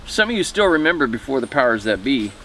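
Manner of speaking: usual speaking voice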